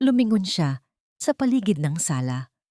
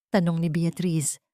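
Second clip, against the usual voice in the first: plain reading voice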